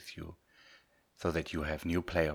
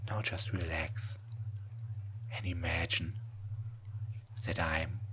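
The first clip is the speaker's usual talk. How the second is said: right in your ear; 4 dB quieter than usual. Acted. Hypnosis trance induction, whispered.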